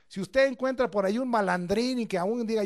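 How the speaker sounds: ordinary delivery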